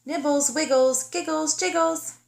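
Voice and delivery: sing-song call for the bunnies. Acted. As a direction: call her siblings across the house. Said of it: "She called to her bunny family".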